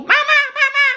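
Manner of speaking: high-pitched cry for Mama; squealed mock tattletale call; mock tattling sibling. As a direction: imitate a kid telling on someone to Mama.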